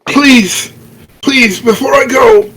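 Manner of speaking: high, melodramatic dying gasp; having a heart attack. Acted. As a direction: ham up a fake death scene. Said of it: "dramatically clutch my chest".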